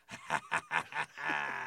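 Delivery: loud burst of imitated laughter; kid's loud laugh, imitated; mock cackle. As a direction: cut loose with a big copied cackle. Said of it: "and just go".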